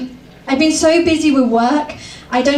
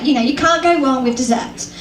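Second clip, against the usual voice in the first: ordinary talk, nothing marked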